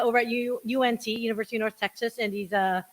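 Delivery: usual speaking voice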